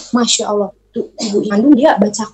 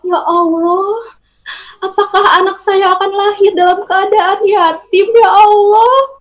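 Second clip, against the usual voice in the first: high, pleading cry to God; pleading prayer; 8 semitones higher than usual. Acted. Mother prays her baby won't be born fatherless, pleading. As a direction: beg God for the husband's life. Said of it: "Kata ibu itu".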